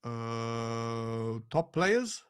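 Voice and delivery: low, hesitant, baffled executive; soft, halting Scandinavian stiffness; Johan Lang. Acted. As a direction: stall quietly, then echo it as a question. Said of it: "if you do Johan Lang".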